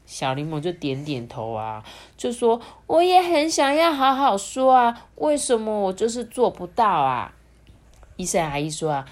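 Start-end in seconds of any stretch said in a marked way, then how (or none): 2.9-7.3 put-on high, loud plaintive child voice; frustrated child. A narrator's reading. Little Lemon wonders why he can't do it, frustrated. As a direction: complain helplessly, easing down at the end.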